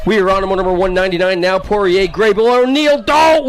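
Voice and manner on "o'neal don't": alarmed cry. Real, not acted. Announcer crying out as O'Neal crashes. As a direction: cry out as he goes down.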